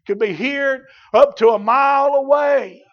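projected proclamation. Real, not acted. Preacher telling how far Whitefield's voice carried, projected proclamation.

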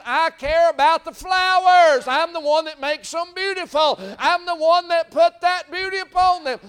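fervent pulpit voice; God's voice preached. Retold or imitated. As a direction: cry out God's care for the flowers. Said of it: "He's simply saying".

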